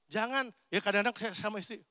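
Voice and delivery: ordinary talk, nothing marked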